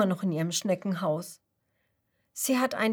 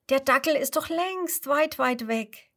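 reassuring grasshopper; 6 semitones higher, 4 dB louder than usual. Acted. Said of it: "ruft das Grashüpfermädchen".

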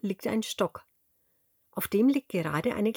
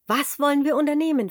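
high, fast and louder; eager piglet question; 6 semitones higher, 4 dB louder, faster than usual. A narrator's reading. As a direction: rush the question out with excitement. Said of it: "fragt das kleine Schwein".

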